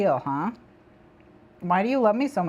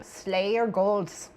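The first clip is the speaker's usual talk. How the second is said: slow attempt at Scottish drifting into Norwegian; vaguely Norwegian accent; 3 dB quieter, much slower than usual. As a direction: less piratey, oddly Norwegian accent, drawn out. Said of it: "less piratey this time and possibly more Norwegian".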